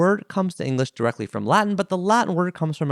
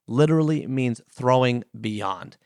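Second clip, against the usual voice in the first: ordinary delivery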